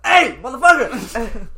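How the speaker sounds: loud, drawn-out holler; imitated shout. Imitating man yelling beside his truck, drawn-out shout. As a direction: holler it slow and high.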